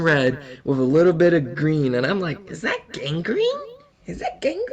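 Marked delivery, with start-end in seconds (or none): 2.5-4.7 softer, rising squeak of disbelief; squeamish question. Acted. Looking at his throbbing toe, squeamish high question. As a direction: voice sliding high on gangrene.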